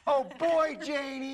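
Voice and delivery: high, sing-out catchphrase; playful high-pitched send-off; catchphrase call. Pretend. Farewell catchphrase called out.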